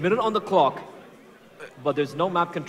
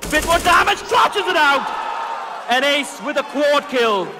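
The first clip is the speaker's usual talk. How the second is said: high roaring hype shout; excited shout; 11 semitones higher, 6 dB louder, slower than usual. Real, not acted. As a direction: scream the clutch ace. Caster screaming the ace.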